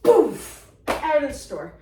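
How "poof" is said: loud, high vanishing sound effect; loud cartoon poof. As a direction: burst it out like a puff of smoke. Lady storming out of store.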